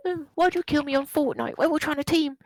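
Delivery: put-on high-pitched whiny gamer kid. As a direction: mimic a whining young kid. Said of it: "It's just kids just going".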